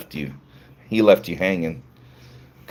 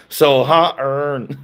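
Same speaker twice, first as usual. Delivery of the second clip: mock [name] accent; 5 semitones higher, 3 dB louder, much slower than usual.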